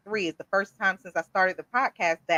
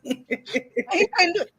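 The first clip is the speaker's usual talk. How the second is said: laughing through the words, slow; 8 semitones higher, much slower than usual. Real, not acted. Host recalling contractor failing at bubbles, laughing. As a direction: crack up as you say it.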